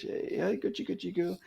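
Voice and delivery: teasing a caged tiger before the take, soft cooing; baby talk. Retold or imitated. Coaxing the tiger in its cage.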